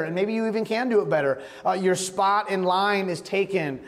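ordinary delivery

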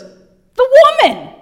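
high, loud and drawn-out; Adam passing the blame; mock whiny blame. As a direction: whine it out as an excuse. Adam blaming Eve, mocking.

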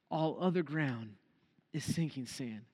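low, soft and grave; hushed solemn repeat. Real, not acted. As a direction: let the hymn line sink in quietly. Hymn line repeated for solemn weight.

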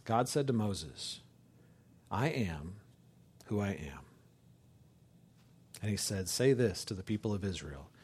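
low, soft reading with long pauses; solemn scripture reading. Acted. Preacher reading Exodus to the congregation, hushed and solemn.

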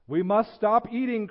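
loud, high-pitched alarm; crying out. A narrator's reading. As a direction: cry out in urgent protest. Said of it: "cried Toad as he ate another".

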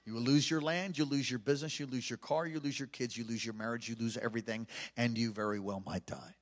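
low, soft, grave listing of losses; hushed prophetic warning; prophesying. Retold or imitated. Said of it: "And I went on to prophesy".

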